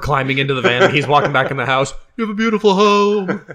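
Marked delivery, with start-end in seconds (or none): 2.2-3.5 high, squeaky mock-shy compliment; nervous overly polite. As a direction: gush it in a tiny high voice. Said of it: "I, I, I'm shy".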